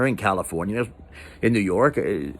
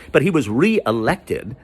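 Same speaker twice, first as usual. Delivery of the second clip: usual speaking voice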